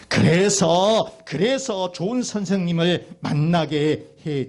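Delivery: slow, drawn-out sermon proclamation; loud opening, held-out words; emphatic preaching. Real, not acted. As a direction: stretch it out like a preacher building to amen. Preaches to the congregation.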